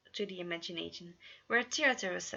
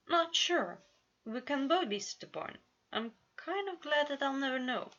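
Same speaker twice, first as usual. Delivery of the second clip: lightly voiced Roman, higher and a bit wistful; Roman confessing; 7 semitones higher than usual. A narrator's reading. Roman admits to Virgil he's glad, mildly higher voice. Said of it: "he confessed".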